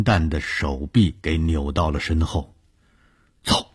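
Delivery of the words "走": stern shout. Acted. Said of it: "保安吼着".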